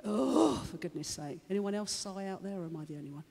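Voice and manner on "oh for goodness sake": big loud "Oh", then dropping away; mock exasperated huff, heaved out; agitated sighing. Preacher imitating himself annoyed by everyone, exasperated sigh. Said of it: "I sigh about things when I'm really agitated".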